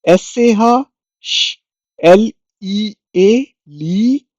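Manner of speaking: slow letter spelling; spelled out slowly. Shown as a demonstration. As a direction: spell it out for the learner. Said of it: "श्लीसन".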